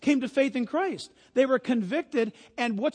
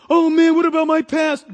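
loud, higher acted outcry; mock protest; 5 semitones higher, 12 dB louder than usual. Preacher plays believer asking about the past, loud mock protest. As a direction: blurt it out as a worried believer. Acts out a worried believer's question.

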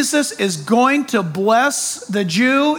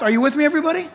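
ordinary talk, nothing marked

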